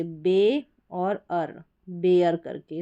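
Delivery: बे and अर said apart, very slowly; syllable split. Shown as a demonstration. Teacher splitting bear slowly for students. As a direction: break बेयर into बे and अर. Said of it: "इस तरीके से".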